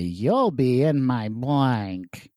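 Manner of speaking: very slow, arch game-show voice; Charles Nelson Reilly. Acted. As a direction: stretch it out like a campy panelist. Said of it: "I tried to deliver it like Charles Nelson Riley".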